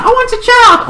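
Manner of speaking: very high, squeaky demand; mock high-pitched plea; insistent wail. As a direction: whine it high like a pleading kid.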